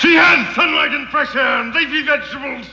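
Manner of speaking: angry retort; indignant shout. Acted. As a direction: snap back in outrage. Quarrel over captive woman.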